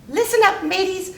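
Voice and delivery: high-pitched parrot calling out; parrot's announcement. A narrator's reading. Parrot rallies would-be pirate crew, high announcing voice. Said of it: "announced the parrot".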